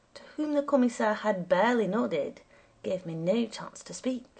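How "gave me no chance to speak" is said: usual speaking voice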